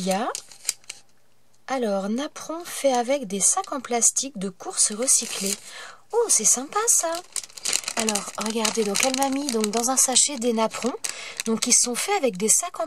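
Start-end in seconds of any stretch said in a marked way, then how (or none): 6.1-7.1 high, bright burst of delight; surprised pleasure at the gift. Real, not acted. (ravie, voix qui monte)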